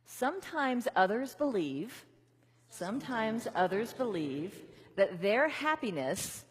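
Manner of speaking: slow, measured call in pieces; slow repeat-after-me. Shown as a demonstration. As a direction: give it line by line to the room. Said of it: "repeat them back with me".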